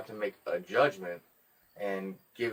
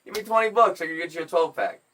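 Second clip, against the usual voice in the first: loud, higher mock outrage; retold scornful refusal; 6 semitones higher, 5 dB louder than usual. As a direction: turn the request down in amused disbelief. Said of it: "I laughed in his face".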